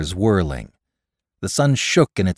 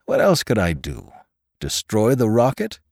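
usual speaking voice

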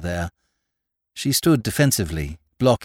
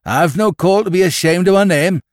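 high, loud indignant farmer; defiant retort; 8 semitones higher, 6 dB louder than usual. A narrator's reading. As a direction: answer back with surly pride.

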